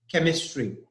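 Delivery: chemistry begins with a hard k. Shown as a demonstration. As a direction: say chemistry with a k, not a ch. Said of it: "I am pronouncing it as ka".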